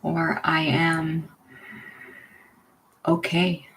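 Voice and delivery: unhurried, leave room for each breath; guided meditation. Real, not acted. Leading listeners into belly breathing, guided meditation.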